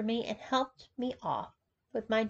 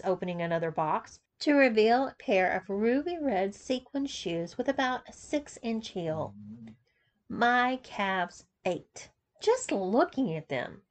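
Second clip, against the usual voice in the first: ordinary delivery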